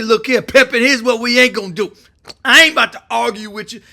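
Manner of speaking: loud, fast, no-nonsense warning; tough alpha talk. Retold or imitated. A tough leader on the phone, loudly laying down the law. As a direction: shut the argument down, loud and brisk. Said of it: "especially men, alpha men, want".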